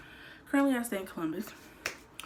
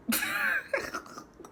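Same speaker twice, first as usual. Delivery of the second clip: high burst of laughter. Real, not acted. Laughs at own impression.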